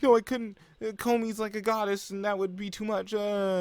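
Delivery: high put-on girlish protest; imitated girl overwhelmed by the idea; flustered refusal. Acting out flustered girl refusing friendship.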